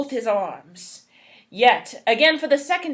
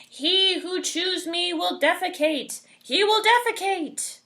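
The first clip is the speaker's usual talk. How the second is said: talking bulb voice; 7 semitones higher than usual. Acted. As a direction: chant it like a magic bulb. Bulb warning repeated nearby.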